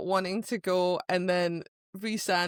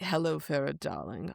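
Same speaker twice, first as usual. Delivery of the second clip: suave purr; 6 semitones lower, 7 dB quieter than usual. Retold or imitated. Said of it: "he's like".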